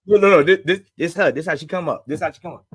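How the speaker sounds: usual speaking voice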